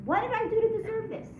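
the bitter question after something bad happens; complaining. Shown as a demonstration. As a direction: whine it like life has wronged you. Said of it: "We always say when something bad happens".

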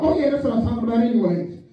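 fast offhand brush-off; quick mock nonchalance; playing it off. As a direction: hurry past the letdown. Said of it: "He tried to play it off".

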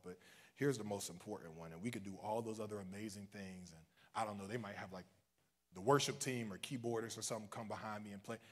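normal talking voice

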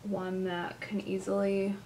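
talking while doing mascara; distracted, mouth held for mascara; applying mascara. Real, not acted. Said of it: "I can't talk and do mascara".

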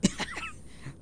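very high falsetto laugh burst; high-pitched laughing squeal; squeaky giggle. Real, not acted. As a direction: let a squeaky giggle slip. Laughs at the find.

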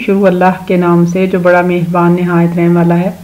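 quick devout recitation of the opening blessing; recited bismillah. Real, not acted. Teacher to children, a brisk devout invocation.

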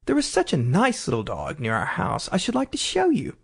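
high, eager chatter as Alice. A narrator's reading. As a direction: gush on about the dog. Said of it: "Alice went on eagerly".

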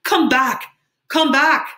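high, loud, rising holler; frantic far-carrying yell; desperate scream. A narrator's reading. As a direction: scream after a departing ship. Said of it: "screamed at the ship".

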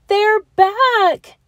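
very high, loud joyful cheer. Acted. Kids cheering the silent letters' return, loud high cheer. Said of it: "the kids cheered loud and clear".

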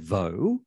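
"Vaux" as French voh, no final consonant. Shown as a demonstration. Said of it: "the French pronunciation".

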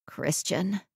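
soft, weak croak. A narrator's reading. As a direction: croak it out faintly. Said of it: "and she croaked".

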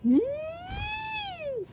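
a sudden high giggle that fades soft; high squeaky laugh. Real, not acted. Streamer squeals as her character nearly falls. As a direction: squeal it out, then let it trail off.

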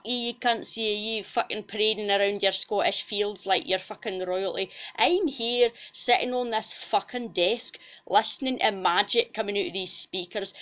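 sweary, indignant Scots tirade; comic angry rant in broad Scots; ranting Scottish cow. Acted. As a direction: tear into them as an outraged Scottish character. [name]'s angry rant at Scottish cows.

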